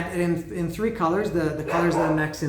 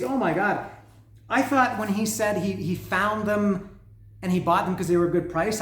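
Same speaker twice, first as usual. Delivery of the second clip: normal talking voice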